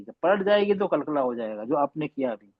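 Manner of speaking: ordinary talk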